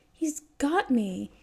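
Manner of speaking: high, loud, stretched slow mock cry; theatrical mock despair; hammed-up defeat. A narrator's reading. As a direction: overplay being overpowered for the kid. Staggering, pretending defeat.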